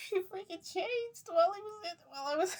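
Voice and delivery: high-pitched, breaking into laughter; laughing disbelief. Real, not acted. She reveals that he changed midway, laughing in disbelief. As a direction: squeal it out through real laughter.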